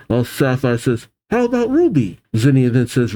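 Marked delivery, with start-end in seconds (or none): 1.3-2.2 high-pitched. Acted. Sapphire's high voiced question.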